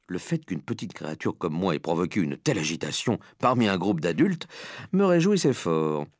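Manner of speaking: ordinary delivery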